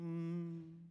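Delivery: low, soft, drawn-out "mm"; long quiet closed-mouth hum; ignoring hum. Shown as a demonstration. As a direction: hum dismissively to snub someone. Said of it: "I will ignore you".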